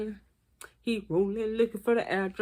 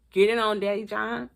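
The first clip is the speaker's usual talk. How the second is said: usual speaking voice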